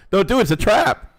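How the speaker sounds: loud, high, laughing warning; excited warning. Real, not acted. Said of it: "Oh, I mean, no, let me say".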